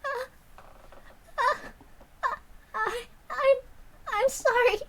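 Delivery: high, broken, choking on each word; tearful stammer. Acted. Sadako apologizing to the class, high tearful stammer. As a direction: stutter out a frightened apology through tears.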